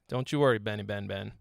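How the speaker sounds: normal talking voice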